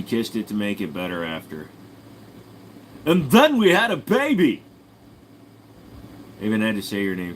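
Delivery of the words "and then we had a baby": high, loud gleeful exclamation; mock excitement. Streamer caps a story about his lady, high mock-excited shout.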